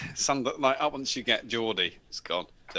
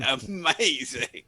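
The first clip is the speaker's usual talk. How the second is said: drawn-out amazing; 3 semitones higher, 4 dB louder, much slower than usual. Retold or imitated. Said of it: "I was like".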